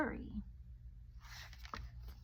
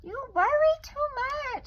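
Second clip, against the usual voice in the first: much higher, louder, slow chiding mother voice; high-voiced mother; 10 dB louder, slower than usual. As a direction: play the mother high and drawn out, scolding gently. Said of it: "said her mother".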